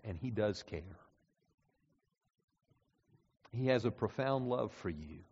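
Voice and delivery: soft comforting sermon voice; tender pastoral appeal. Acted. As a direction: speak gently to the heartbroken.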